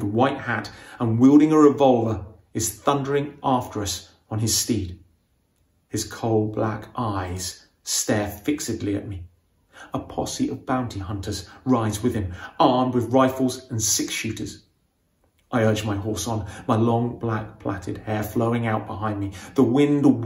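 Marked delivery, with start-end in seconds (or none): none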